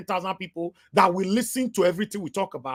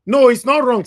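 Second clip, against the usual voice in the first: louder, higher objection; emphatic rebuttal; 4 semitones higher, 9 dB louder than usual. Real, not acted. Commentator contradicting the guest's claim on statistics, loud and emphatic. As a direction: cut in loudly to contradict him.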